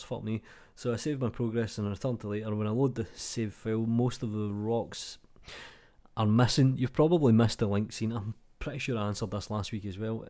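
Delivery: normal talking voice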